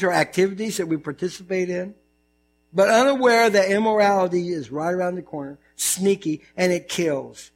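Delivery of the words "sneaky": ordinary talk, nothing marked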